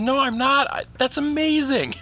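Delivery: very high, squeaky tearful gush; teary awe. Retold or imitated. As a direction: imitate the wife overwhelmed by the castle. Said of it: "And she goes".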